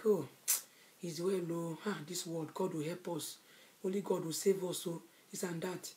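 very soft, low murmured comfort; hushed, low, resigned reassurance; retold consoling. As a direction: imitate someone gently soothing a crying friend. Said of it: "She was consoling me".

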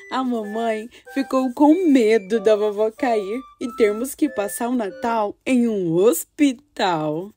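put-on gentle storytelling for children; story narration. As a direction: read it like a child's diary. Reads girl's diary story.

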